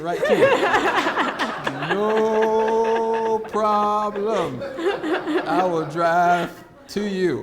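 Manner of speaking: laughing his way through the offer; giddy laugh over the easy deal. Retold or imitated. Laughing while retelling his own offer.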